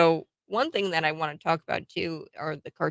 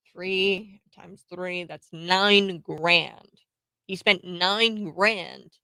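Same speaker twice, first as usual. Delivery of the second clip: slow emphasis; much slower than usual. Real, not acted. Stressing cost, slowed math.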